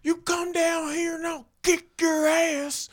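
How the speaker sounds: very high, slow put-on voice daring someone. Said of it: "And he goes".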